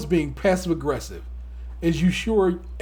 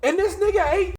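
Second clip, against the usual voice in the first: outraged exclamation; 9 semitones higher than usual. Acted. Co-host appalled that a husband ate her chocolate, outraged. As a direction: cry it out high in comic disbelief.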